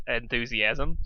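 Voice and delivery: ordinary delivery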